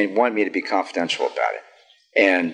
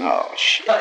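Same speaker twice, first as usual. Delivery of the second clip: retold dismay; 11 semitones lower than usual. Dread at sponsor's blunt question.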